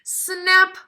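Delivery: loud, high, punchy snap; sharp snap. Acted. Teacher acting the tusk breaking for the kids, loud snap.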